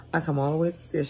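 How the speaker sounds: normal talking voice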